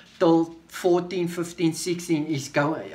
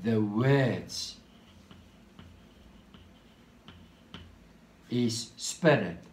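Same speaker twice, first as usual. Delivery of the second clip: slow reading; 4 semitones lower, 4 dB quieter, much slower than usual. Shown as a demonstration. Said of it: "I'm going to read it slowly now".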